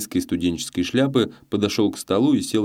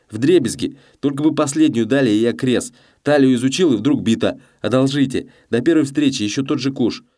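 higher-pitched, excited gambler's plea; desperate gambling baron; 6 semitones higher, 4 dB louder than usual. A narrator's reading. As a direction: plead eagerly for one more stake.

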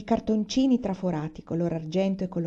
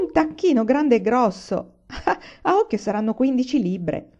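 gleeful and laughing; 5 semitones higher, 4 dB louder than usual. Acted. Papà shows off the turkey.

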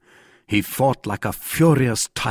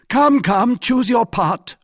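high, loud, impatient demand; impatient urging; 13 semitones higher, 5 dB louder, slower than usual. A narrator's reading. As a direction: press them in a raised, high voice. Jussac presses for a decision.

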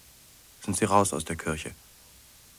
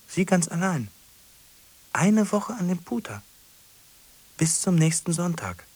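agitated; 10 semitones higher, 5 dB louder than usual. Acted. Rising agitation.

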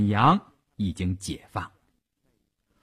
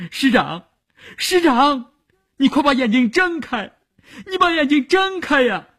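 high-pitched desperate cry, building higher; 尖着嗓子喊; 4 dB louder than usual. A narrator's reading. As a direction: cry out shrilly to rouse an unresponsive commander. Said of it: "他尖着嗓子喊". (尖着嗓子哭喊)

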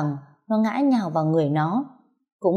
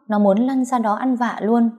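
ordinary delivery

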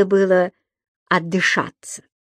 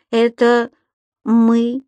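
usual speaking voice

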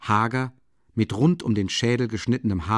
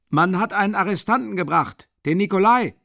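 much higher, louder voice behind the door; voice from outside; 10 semitones higher, 5 dB louder than usual. A narrator's reading. A guard reports through the door, loud and high. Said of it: "antwortete eine Stimme".

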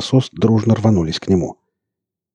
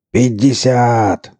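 slow drawn-out loud yell; 4 semitones higher, 4 dB louder, much slower than usual. Acted. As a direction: stretch the yell out slowly. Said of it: "снова завопил Борланд".

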